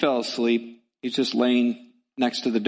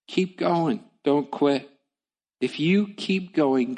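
usual speaking voice

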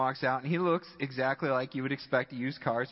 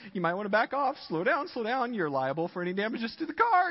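higher-pitched imitation of polite caution; mock gentle warning; 7 semitones higher, faster than usual. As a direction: play a nervous passenger politely warning the driver. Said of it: "The salesman is just gentle".

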